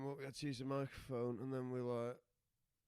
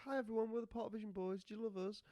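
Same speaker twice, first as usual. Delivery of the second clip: high, bright stage announcer voice; hammed-up cheery greeting to the crowd; mock stage greeting; 10 semitones higher, faster than usual. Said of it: "we're like".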